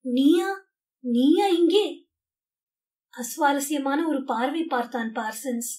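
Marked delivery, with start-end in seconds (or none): 0.0-1.9 higher, louder shocked outburst; startled cry. Acted. Winston, shocked to see Parsons in the cell, cries out. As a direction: blurt it out, forgetting himself. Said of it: "தன்னை மறந்துவிட்டு கூவினான்".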